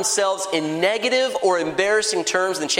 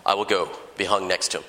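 low, mock-heroic declaration; deep voice of a hammed-up brave hero; courageous; 10 semitones lower, 3 dB quieter than usual. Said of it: "Does he courageously say".

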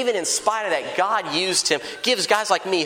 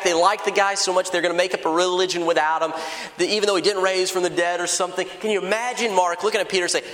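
ordinary delivery

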